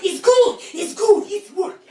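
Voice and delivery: loud, high, laughing imitation; gleeful uncle. Uncle pouring borax, gleeful loud crowing. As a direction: crow it like a delighted uncle, mid-laugh. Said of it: "and he's just laughing".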